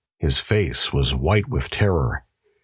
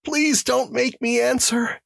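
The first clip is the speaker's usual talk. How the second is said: much higher, begging; frightened plea. Acted. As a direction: beg not to answer. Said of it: "pleaded the prisoner".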